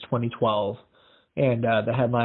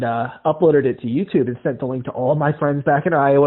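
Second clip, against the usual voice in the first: ordinary talk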